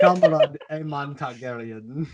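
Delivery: slow, laughing fake Russian accent; mock Russian accent. Co-host jokes about Comrade Aemon, bad Russian accent. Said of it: "That was not a Russian accent".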